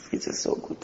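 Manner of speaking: breathy whispered echo of the line; whispering the longing aloud. Acted. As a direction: drop to a secret whisper, dreamy.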